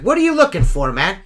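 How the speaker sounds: loud, high challenge to a driver; confronting call-out. Retold or imitated. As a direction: call him out sharply.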